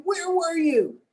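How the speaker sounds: mock-angry mother-style scolding; angry daughter. Daughter scolds her late-returning mom, mock angry. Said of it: "she was really angry".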